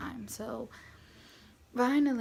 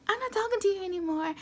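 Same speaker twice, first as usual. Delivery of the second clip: high, quick mock huff; fed-up dismissal; 10 semitones higher, 4 dB louder, faster than usual. Mom giving up arguing with the husband, fed up. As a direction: cut him off in a high, fast voice.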